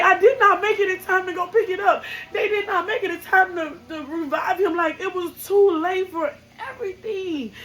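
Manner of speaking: high, loud sobbing, voice breaking; crying. Real, not acted. Mother telling her son she was working, sobbing and breaking. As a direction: talk to your lost son through real tears.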